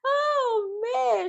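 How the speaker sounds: high laughing wail; laughing cry. Real, not acted. Host cracking up at the hand-washing drama, laughing wail. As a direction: crack up into a squeal.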